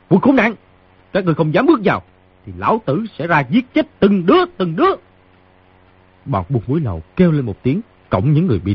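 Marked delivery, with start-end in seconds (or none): 0.1-4.9 shouted threat. A narrator's reading. Wounded fighter threatening the smugglers, high acted shout. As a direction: hurl the threat in a strained high voice. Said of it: "Chỉ nghe người trong phòng quát tiếp".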